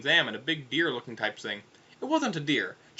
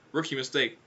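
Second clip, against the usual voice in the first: ordinary delivery, nothing marked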